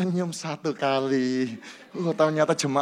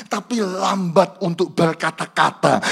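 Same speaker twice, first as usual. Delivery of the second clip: ordinary talk, nothing marked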